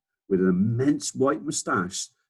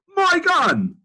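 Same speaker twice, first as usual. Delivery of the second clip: much higher, louder outraged bellow; furious Duke shouting; 9 dB louder than usual. Acted. Duke screaming for his gun at the bird, much higher furious shout. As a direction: rage like a robbed old aristocrat. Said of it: "the Duke was shouting".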